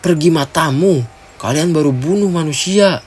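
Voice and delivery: high-pitched angry retort; outraged shout. A narrator's reading. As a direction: throw it back at them in outrage. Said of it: "teriak Mira".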